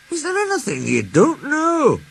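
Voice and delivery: amazed Douglas. A narrator's reading. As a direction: marvel at the boss. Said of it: "gasped Douglas".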